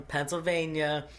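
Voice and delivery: usual speaking voice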